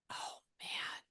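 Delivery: breathy whispered aside. Real, not acted. Medium whispering as the spirit box starts. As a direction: whisper it under your breath. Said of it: "get really quiet for about ten seconds".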